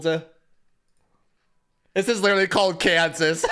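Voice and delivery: slowed words breaking into laughter; amused disbelief, laughing. Real, not acted. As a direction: say it while cracking up. Laughs at the song title.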